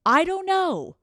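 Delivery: high, loud, exasperated outburst; exasperated high yell. Retold or imitated. Girlfriend snapping at her clueless boyfriend, loud and high. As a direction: blow up at him, fed up.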